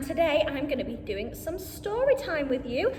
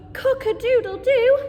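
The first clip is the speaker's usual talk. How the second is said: loud, higher rooster call; rooster crow; 6 semitones higher, 8 dB louder than usual. Acted. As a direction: crow along with the children. Children repeat animal noises.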